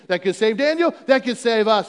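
normal talking voice

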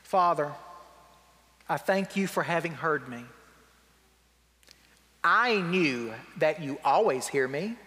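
a little snippy. Retold or imitated. Jesus praying aloud for the listening crowd, a little snippy. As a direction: read the prayer low and deliberate, slightly testy. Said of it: "Jesus gets a little snippy".

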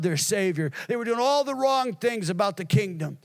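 high, driving sermon voice; impassioned preaching. Real, not acted. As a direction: preach it out, slowing on the last words. Preaching at full force.

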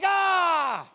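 loud high mock shriek; mock widow shriek. Widow shrieking at the judge, hammed-up shriek. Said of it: "She's like".

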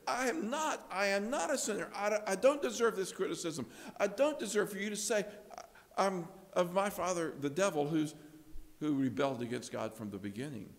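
defensive protest. Retold or imitated. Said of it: "go down the defensive route and say".

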